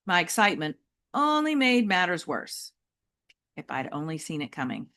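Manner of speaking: ordinary delivery, nothing marked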